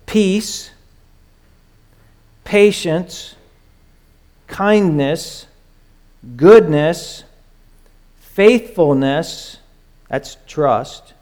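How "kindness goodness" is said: ordinary talk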